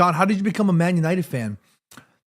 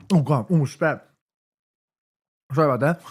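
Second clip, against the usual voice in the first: ordinary delivery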